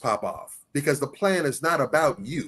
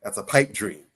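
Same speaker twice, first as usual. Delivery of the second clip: "pipe" hit a touch harder than "dream"; stress on pipe; 6 semitones lower than usual. Real, not acted. Speaker dismissing a vague plan, stress on "pipe". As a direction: lean on the word "pipe". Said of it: "Emphasis on the pipe".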